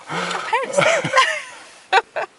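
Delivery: high, giggly retold question; laughing question. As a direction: ask it while laughing at yourself. Laughing retelling own cheeky question.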